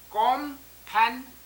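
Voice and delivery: Compensation split into कॉम, पेन, से, शन; four separate beats with pauses in compensation; syllable by syllable. Shown as a demonstration. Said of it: "कॉम एक syllable है".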